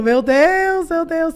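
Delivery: high, stunned oh-my-God; mock amazement. Everyone watching live reacts, mock amazement. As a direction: imitate an onlooker's gasping disbelief. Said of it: "todo mundo ficou".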